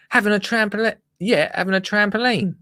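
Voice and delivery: silly high-pitched grumbling; mock Richard Suckett. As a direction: mimic a fuming complainer, pitched right up. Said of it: "he doesn't speak like this".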